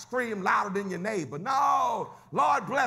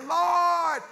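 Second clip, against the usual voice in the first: high, loud climactic cry; shouted prayer; 7 semitones higher, 3 dB louder, much slower than usual. Acted. Preacher's climax to the congregation, full shout. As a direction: shout it to the rafters.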